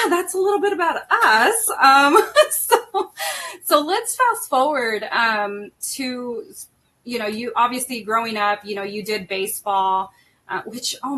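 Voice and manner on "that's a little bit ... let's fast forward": laughing through tears. Real, not acted. Mother tearing up on son's show.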